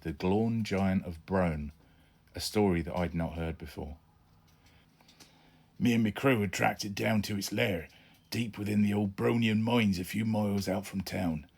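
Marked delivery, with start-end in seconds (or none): 5.8-11.5 put-on West Country pirate accent. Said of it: "try to do it in that kind of voice".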